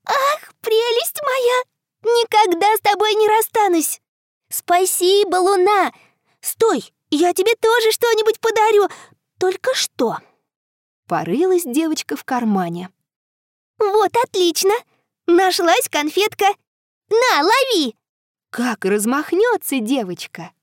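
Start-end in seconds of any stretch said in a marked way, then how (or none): 0.1-10.2 high, thrilled, gushing; delighted little girl. Acted. 13.8-18.0 put-on excited little girl. Girl throws candy.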